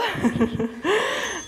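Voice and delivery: rising laugh after wobble. Real, not acted. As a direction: laugh at nearly falling. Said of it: "Felt like I was on a unicycle".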